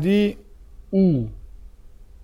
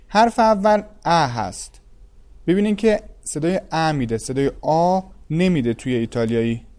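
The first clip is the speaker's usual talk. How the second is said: ordinary delivery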